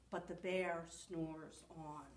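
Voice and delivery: soft whispery lullaby refrain; hushed refrain. A narrator's reading. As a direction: drop to a hush for the sleeping bear. Sleepy refrain of snoring bear.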